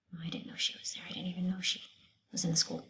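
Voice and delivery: hushed, unvoiced aside; whisper. Narrator whispering a joke to the listeners.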